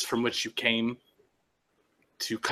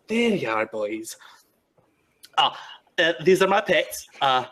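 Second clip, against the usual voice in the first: eager Nyctis; 3 semitones higher, 3 dB louder than usual. Acted. Nyctis introduces his pets.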